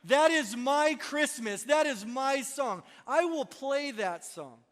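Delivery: loud, high, eager yes; excited agreement. Pretend. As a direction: cheer it like it's finally your song. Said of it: "Then we would all say".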